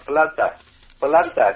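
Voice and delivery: Flandre with an L in place of the R, slowly, syllable by syllable; slow Chinese-style Flandre; Chinese France. Shown as a demonstration. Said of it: "cho họ sẽ đọc".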